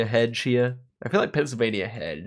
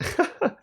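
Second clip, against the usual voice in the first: short high laugh. Real, not acted. Amazed at the bot's guess.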